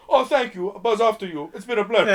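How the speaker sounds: high, loud foreigner impression; hammed-up, high-pitched thanks breaking into a laugh; Roberto. Quoting the film's foreigner, in a high mock voice. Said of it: "line from Roberto here".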